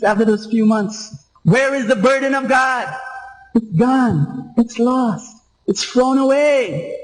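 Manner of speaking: high, sustained preacher's pitch; fervent preaching. Real, not acted. Preacher recalling lost zeal to the congregation, high fervent preaching. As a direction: press the point with rising fervour.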